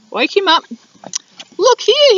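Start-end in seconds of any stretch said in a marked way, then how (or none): 1.6-2.1 high, louder yawned words; sleepy koala yawning awake. Acted. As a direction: stretch it out through a yawn. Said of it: "he yawned".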